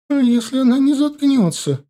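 high, loud panicked question; pleading in fear; frightened cry. A narrator's reading. Frightened wife asks.

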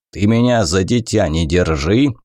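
plain reading voice

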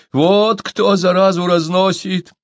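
high, loud, drawn-out outcry; loud and high, stretching the words; exclaiming accusation. A narrator's reading. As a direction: cry out the accusation. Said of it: "воскликнул Кога".

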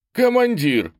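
high, loud glad cry; overjoyed call. A narrator's reading. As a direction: call out delighted to see your commander. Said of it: "обрадовался его появлению Гремшик". (радостный высокий возглас)

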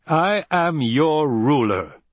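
high, loud, slow declaration; imperious anger. A narrator's reading. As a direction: assert authority, each word drawn out. Emperor asserts rule over Paul.

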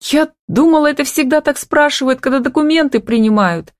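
high, upset protest on the edge of crying; acted tearful complaint, pitched well up; tearful wail. A narrator's reading. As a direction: whine it out high, as if bursting into tears. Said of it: "разревелась Камила".

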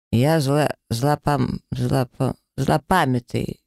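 slurred, tripping over the word; drunken stutter. Acted. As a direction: stumble drunkenly through злопамятный. Drunk elf stumbling on word.

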